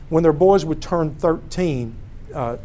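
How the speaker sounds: normal talking voice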